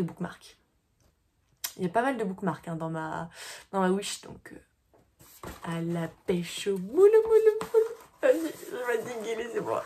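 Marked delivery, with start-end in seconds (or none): 5.6-7.8 playful singsong. Real, not acted. Playful fishing chant.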